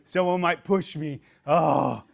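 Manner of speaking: hammed-up nervous fretting with a laugh; playing a jittery rookie scared of the door, half-laughing; mock anxious self-talk. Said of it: "It's what you tell yourself".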